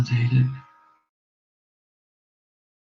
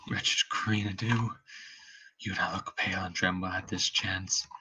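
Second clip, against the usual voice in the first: faint dying voice; 11 semitones lower than usual. Acted. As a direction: let the life drain from the voice. Hamlet dying, fading voice.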